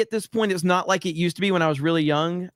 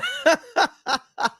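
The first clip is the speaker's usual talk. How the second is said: high wheezing laughter near tears; crying with laughter; 11 semitones higher than usual. Real, not acted. Streamer losing it over the clip, tearful laughter. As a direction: laugh till you almost cry.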